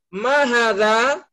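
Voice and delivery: "Ma hadza?" drawn out slowly with a rising end; slow drill question. Shown as a demonstration. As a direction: ask "Ma hadza?" slowly, like a teacher testing. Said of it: "Saya tes". (ustaz bertanya pelan dengan nada naik)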